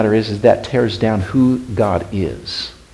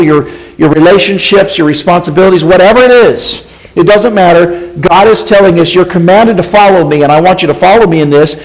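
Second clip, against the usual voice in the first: loud, raised preaching voice; impassioned preaching; 5 semitones higher, 6 dB louder than usual. Real, not acted. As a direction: press it on the congregation with force.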